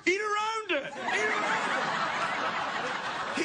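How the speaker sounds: high, stretched-out urging through laughter; laughing urging. Retold or imitated. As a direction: plead with him to just eat. Urging hungry friend to keep eating.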